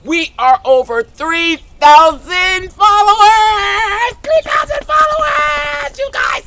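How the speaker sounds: high, loud celebration, drawn out; announcing three thousand followers, shouting excitedly. Real, not acted. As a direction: yell the milestone in joy. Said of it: "I'm so excited".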